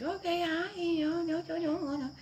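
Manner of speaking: put-on a child giggling at a puppy; granddaughter's voice. Granddaughter voiced playing with dog.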